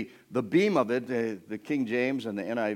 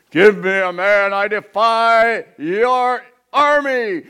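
very loud, slow, higher bellow; Goliath's roar; 9 semitones higher, 16 dB louder, much slower than usual. Acted. Goliath defying the army of Israel, slow bellowing roar. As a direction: hurl the defiance at the army. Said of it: "he goes blaspheming".

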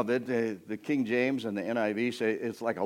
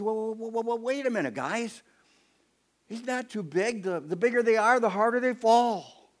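higher, bright-eyed calming call; eager young David; 10 semitones higher, 4 dB louder than usual. Acted. David stopping the fleeing soldiers, higher eager call. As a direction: hold the fleeing guys back, eager. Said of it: "David says".

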